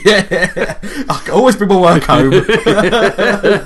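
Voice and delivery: loud, high, words bursting through laughter; laughing. Real, not acted. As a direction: crack up while making the joke. Joking in character, cracking up.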